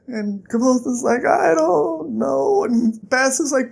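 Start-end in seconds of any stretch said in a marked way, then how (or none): 1.2-2.6 slow, high, stretched-out words; acted hurt whine; feeble reply. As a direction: drag it out helplessly. Hurt Kvothe answers Bast.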